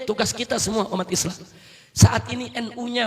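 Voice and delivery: usual speaking voice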